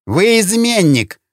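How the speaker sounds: high, drawn-out angry bark; barked accusation. A narrator's reading. Lynch turns on his recent ally, barking angrily. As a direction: hurl it slowly at the traitor, pitch leaping up. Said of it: "рявкнул на своего недавнего союзника Линч".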